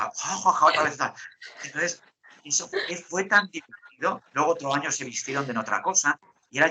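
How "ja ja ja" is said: higher mock "ja, ja, ja"; imitated laughter. Host laughing at the angry interviewee, mock laugh. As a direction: re-enact laughing back at her. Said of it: "y digo".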